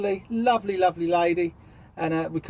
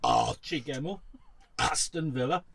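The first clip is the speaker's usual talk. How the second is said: words burped out; burp-speak the names; 2 semitones lower, 6 dB quieter than usual. Shown as a demonstration. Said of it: "I'm an expert".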